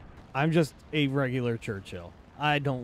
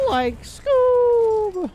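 shrill cartoon squeal, much higher and louder; 10 dB louder than usual. Gamer shrieks Scooby impression at his tank, much higher. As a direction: squeak out the name like a cartoon dog.

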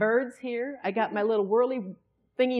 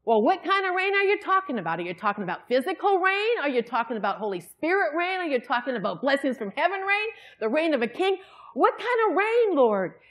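higher, louder pleading questions; exasperated plea; 5 semitones higher, 4 dB louder than usual. Retold or imitated. Preacher retelling frustration at God, raised and pleading. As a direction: demand answers from God, flustered.

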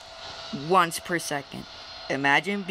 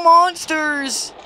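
sarcastic; 10 semitones higher, 7 dB louder, much slower than usual. Real, not acted. Sarcastic mocking the monster.

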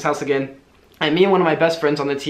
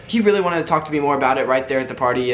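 ordinary delivery

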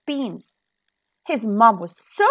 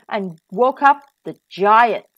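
ordinary delivery, nothing marked

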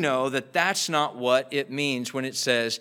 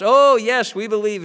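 high, loud eager cheering; a crowd shouting yes, much higher; 7 semitones higher, 7 dB louder than usual. Retold or imitated. Crowd answering the tightrope walker, high and loud eager agreement. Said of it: "And everybody".

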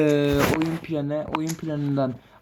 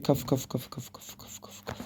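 willing the player on under your breath, fading out; fading to whisper; 6 semitones lower, 15 dB quieter, much faster than usual. Real, not acted. Gamer urging his player on, chanting his name down to a whisper.

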